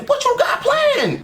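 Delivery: very high, drawn-out excited question; eager demand. Real, not acted. Viewer demands to hear the plan, high eager squeal. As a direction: stretch it out in a high squeal of anticipation.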